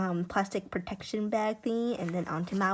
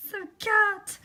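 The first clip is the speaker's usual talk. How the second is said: high, loud burst of delight; excited squeal; 11 semitones higher, 6 dB louder than usual. Real, not acted. Showing a favourite character print, excited squeal.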